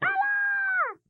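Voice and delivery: high mock yell of Allah; imitated shriek. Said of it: "what they had to be screaming".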